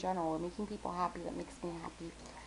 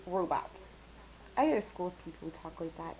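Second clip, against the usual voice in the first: ordinary delivery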